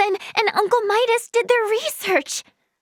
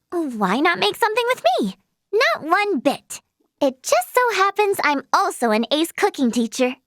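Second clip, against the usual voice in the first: normal talking voice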